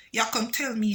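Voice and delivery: usual speaking voice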